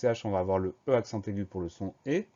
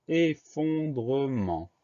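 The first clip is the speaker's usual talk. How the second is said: very slow, higher, "effondrement" drawn out by syllable; syllable-by-syllable dictation; 4 semitones higher, much slower than usual. Shown as a demonstration. Teacher introducing the last word of the list, slow syllable-by-syllable dictation. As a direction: stretch out each syllable of "effondrement".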